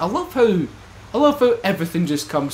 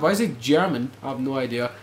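normal talking voice

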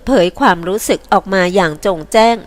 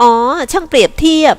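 mocking; 7 semitones higher, 4 dB louder than usual. A narrator's reading. Heroine mocks his comparison.

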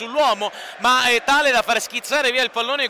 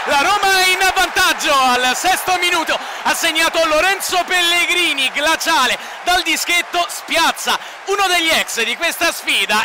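high, loud goal shout; goal call; 5 semitones higher, 4 dB louder than usual. Real, not acted. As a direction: explode as the penalty goes in. Goal call, Roma scores.

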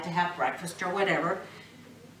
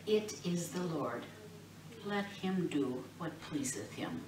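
softer, whispered acceptance; resigned whisper; 6 dB quieter than usual. Acted. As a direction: let it go quietly, resigned.

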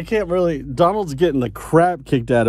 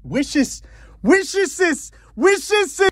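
much higher squeaky voice; high squeaky echo; much slower than usual. Acted. Comic echo of the wish.

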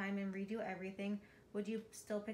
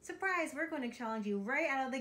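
excited; 4 semitones higher, 6 dB louder than usual. Acted. Host springs a surprise challenge.

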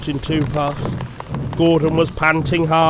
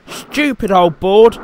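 slow, raised jeer; scornful engine; 5 semitones higher, 6 dB louder than usual. A narrator's reading. Thomas mocks the board as he passes, slow scornful jeer. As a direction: jeer at it, higher and louder. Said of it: "said Thomas as he passed it".

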